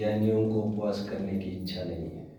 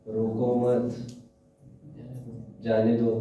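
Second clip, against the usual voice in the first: रोको मत as one phrase, pause, then जाने दो; pause after मत; slower than usual. Shown as a demonstration. Teacher showing how a pause changes meaning, pause after मत. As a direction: break after मत, before जाने दो. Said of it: "ये तो अर्थ का अनर्थ हो जाएगा".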